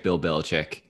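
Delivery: usual speaking voice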